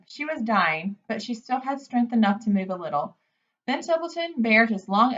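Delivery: usual speaking voice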